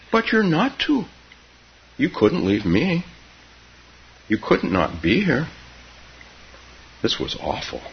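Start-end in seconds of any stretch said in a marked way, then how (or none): 0.1-5.5 put-on higher-pitched childish protest; child's insistence. A narrator's reading. Keza telling Grandma she cannot leave, childish insistence. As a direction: argue like a child who will not accept it.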